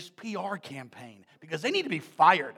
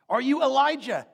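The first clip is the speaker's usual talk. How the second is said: loud, higher, pressing question; demanding question; 4 semitones higher, 7 dB louder than usual. Retold or imitated. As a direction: press him for an answer. Leaders questioning John.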